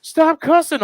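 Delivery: very high mock reprimand; scolding instructor. Laser tag girl scolding visitor, high hammed-up reprimand. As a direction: imitate flustered staff, voice way up. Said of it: "She's like".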